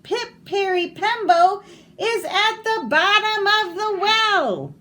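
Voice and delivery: slow, high, loud, sung-out call; last desperate shout. Acted. Exhausted boy shouts the long name to the old man, sing-song. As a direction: pour the last breath into the call. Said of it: "he shouted".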